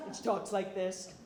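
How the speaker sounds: sister's deep voice, lightly imitated. Storyteller demonstrating her sister's voice after the throat injury, deep voice. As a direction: dip into a mock-deep register. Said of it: "She has a really deep voice".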